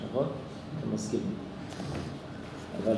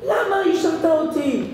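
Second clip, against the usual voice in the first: put-on much higher child's accusing cry; hurt child. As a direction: demand an answer from dad. Child protests to father.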